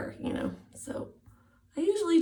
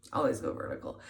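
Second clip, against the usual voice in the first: whispered; 8 semitones lower, 4 dB quieter, faster than usual. Shown as a demonstration. Repeated as a whisper.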